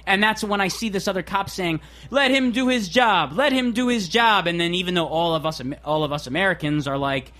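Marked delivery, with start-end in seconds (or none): 2.1-4.5 slow, higher, stern cop voice; mock cop order. Narrator mimics cop ordering onlookers, slow stern voice. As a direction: drag it out like a bossy cop.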